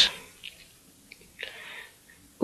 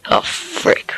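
whispered curse; 7 dB quieter than usual. Real, not acted. Startled by something in cave.

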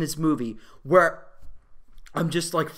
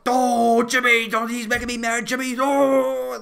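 mock crazy guy; 3 semitones higher, 3 dB louder than usual. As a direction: imitate an unhinged bully losing it. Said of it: "guy who's even fucking crazier".

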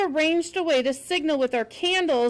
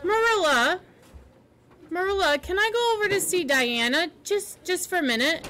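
put-on eager girl voice; 5 semitones higher than usual. Anne begging, voiced character.

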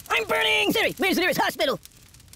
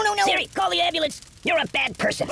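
usual speaking voice

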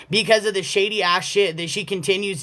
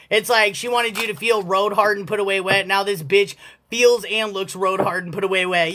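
ordinary delivery

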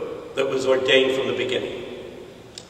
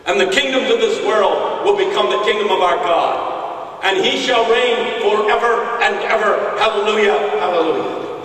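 higher, ringing proclamation; triumphant preaching; 5 semitones higher than usual. Acted. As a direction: proclaim it to the congregation.

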